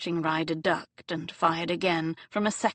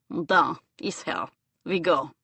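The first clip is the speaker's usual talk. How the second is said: clipped Russian henchman; a light Russian accent. Acted. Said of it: "she placed the man's accent. Russian".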